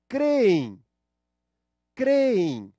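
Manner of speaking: CREem said loud with the stress on cre; stressed syllable shown. Shown as a demonstration. Teacher shows students the stressed syllable, loud. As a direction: hit the first syllable of creem hard. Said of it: "a sílaba tônica é o cre".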